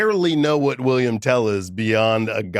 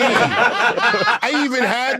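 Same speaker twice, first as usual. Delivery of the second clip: bragging celebrity; 9 semitones higher, slower than usual. Pretend. Voices a celebrity bandwagon fan.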